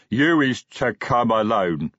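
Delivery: slow, heavy-tongued troll voice; lumbering troll messenger. A narrator's reading. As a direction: plod through it word by word. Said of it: "said the troll".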